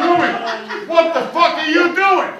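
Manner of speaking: outraged yell. Acted. Outraged shout at woman.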